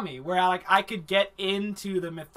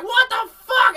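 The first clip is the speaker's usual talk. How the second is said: high, loud shocked yell; hammed-up shocked shout; shocked outburst; 8 dB louder than usual. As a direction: everyone gasping in disbelief. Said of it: "everyone's like".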